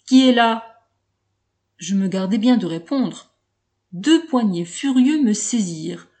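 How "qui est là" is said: loud, sharp challenge into the dark; forceful demand. A narrator's reading. Startled colonel demanding who is there, loud. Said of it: "La voix demanda avec force".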